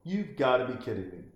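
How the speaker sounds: normal talking voice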